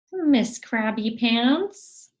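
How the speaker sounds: high, slow, loud teasing; mocking parent. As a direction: draw out the belittling nickname. Parent voiced mocking sad child.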